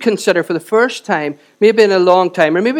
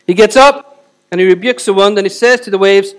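normal talking voice

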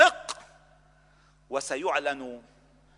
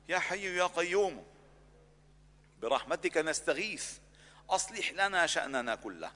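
fervent prayer; 3 semitones lower, 4 dB quieter than usual. Acted. Imam leading the congregation in closing dua, fervent prayer. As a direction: plead with God on behalf of the congregation. Imam's closing supplication, rising fervour.

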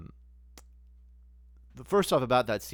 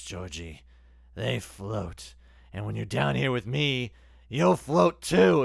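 slow, drawn-out clown menace, loud at the end; sinister clown; 8 dB louder, much slower than usual. Retold or imitated. Clown luring Georgie from the gutter, slow menacing voice. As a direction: lure the boy, then swell the threat. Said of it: "he's like".

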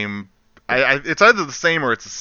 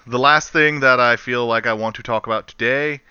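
ordinary delivery

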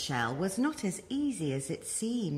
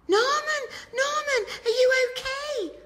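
much higher, louder anxious calling; worried snails; 5 dB louder than usual. Acted. Snails call to Norman after his fall, high and worried. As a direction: call out to a fallen friend. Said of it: "asked the snails".

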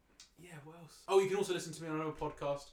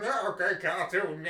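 lightly hammed-up, louder cartoon voice; 5 dB louder than usual. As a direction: do a rough cartoon imitation. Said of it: "He was just like cartoons".

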